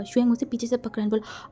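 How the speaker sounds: ordinary delivery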